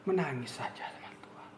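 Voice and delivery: hushed whisper, quick and intimate; whispered plea. Real, not acted. Preacher whispering to the congregation, urging prayer. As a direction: breathe it out like a secret prayer. Preacher urges crying to God.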